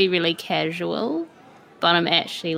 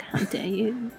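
mock whisper; 10 dB quieter than usual. Withering glance at Ismark.

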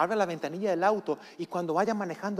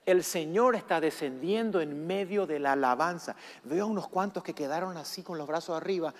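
usual speaking voice

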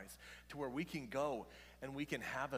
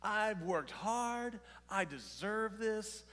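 slow, high, proud boast; mock pride in own hard work; smug self-made boast; 7 semitones higher, 4 dB louder, slower than usual. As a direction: play the self-satisfied achiever. Voicing a self-made attitude.

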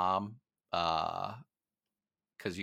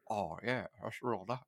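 imitating Jerry West; 8 dB quieter than usual. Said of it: "an impression of Jerry West".

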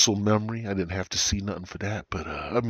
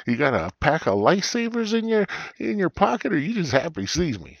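high, louder mock-teasing voice; acted teasing; 9 semitones higher, 4 dB louder than usual. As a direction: tease him in a raised character voice. Phil teases the narrator.

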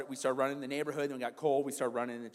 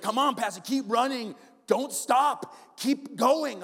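higher, louder push to keep going; coaching shout; 9 semitones higher, 9 dB louder, slower than usual. Retold or imitated. Lewis pushing the pastor to finish, coaching shout. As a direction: drive him on, rising in urgency. Running partner urging him on.

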